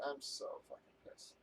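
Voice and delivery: whispered under the breath, voice dropped away; muttered in a fed-up whisper; seething whisper. Real, not acted. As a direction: hiss it out to himself, barely voiced. Repeats his complaint, then whispers it.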